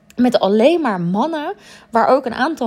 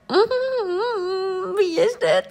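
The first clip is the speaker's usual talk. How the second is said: high, put-on crush voice; spotting Jason, playfully swooning; dreamy interest; 11 semitones higher, much slower than usual. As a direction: hum it out as if struck by love. Retold smitten thought.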